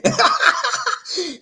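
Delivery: very high-pitched burst of laughter; high cackle. Real, not acted. Host tickled by his Perry Mason joke, high cackle.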